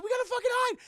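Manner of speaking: high, loud urgent cry; hammed-up alarm; mock panic. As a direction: squeal it like you must flee right now. Acted urgent warning.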